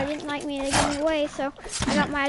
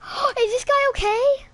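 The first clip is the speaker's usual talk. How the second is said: gasping surprise; 9 semitones higher, 6 dB louder than usual. Retold or imitated. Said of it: "And we was like".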